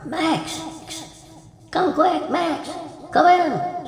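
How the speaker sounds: high-pitched urgent call. Acted. Old wife calls her husband from the bathroom, urgently. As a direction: summon someone from the next room. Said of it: "hears her call".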